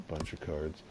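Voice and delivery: normal talking voice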